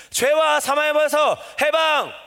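high, drawn-out victory cry; rallying proclamation. Shown as a demonstration. Preacher leading congregation in proclaiming together, rallying cry. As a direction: lead the crowd in a slow triumphant chant. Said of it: "다시".

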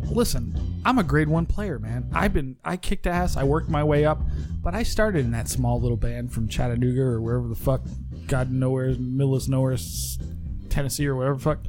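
normal talking voice

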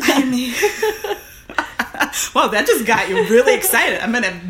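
loud, giggly and high; laughing. Real, not acted. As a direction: laugh through the words. Laughing after cursing joke.

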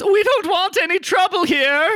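high, loud, frightened voice from behind a curtain; nervous shopkeeper. Acted. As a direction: plead from hiding, high and scared. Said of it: "you hear from behind the curtains".

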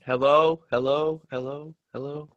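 ordinary talk

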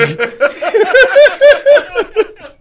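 real high-pitched cackling fit; long helpless burst of laughter; loud high laughter. Real, not acted. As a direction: crack up hard, loud and high. Cracks up at own impression.

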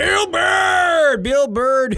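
Bill Bird shouted high and loud; hyped shout. Real, not acted. Hyping the Patreon sponsor, a high, loud shout. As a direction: bellow the name like an announcer.

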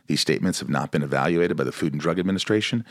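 rapid legal disclaimer read; fast disclaimer. Acted. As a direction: rattle off the fine print fast.

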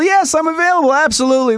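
bright, keen, over-the-top availability; overeager yes. Retold or imitated. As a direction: jump at the job like a hungry actor. Eager actor answering his agent.

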